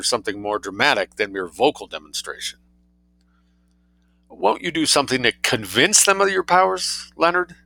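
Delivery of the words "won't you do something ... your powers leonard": high, imploring appeal; coaxing plea in a higher voice; pleading. A narrator's reading. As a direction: plead with rising urgency, higher pitch. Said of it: "she pleaded".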